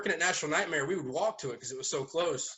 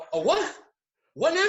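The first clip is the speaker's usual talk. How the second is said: way higher and louder, baffled; incredulous old man; baffled high squawk; 13 semitones higher, 4 dB louder than usual. Retold or imitated. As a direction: play the old cook who can't believe it.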